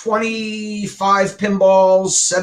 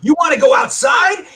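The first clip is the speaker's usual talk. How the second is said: high, raised-voice confrontation; retold yelled challenge; 10 semitones higher, 4 dB louder than usual. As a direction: call them out, voice climbing high. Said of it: "And I started yelling".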